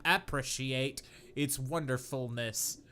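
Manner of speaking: ordinary talk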